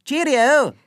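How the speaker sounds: high, loud, bouncy goodbye; cheery sign-off. Acted. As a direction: sing out the farewell. Host signs off cheerily.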